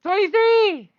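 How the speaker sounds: excited shout. Real, not acted. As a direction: shout the roll, high and loud. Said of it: "You seem angry".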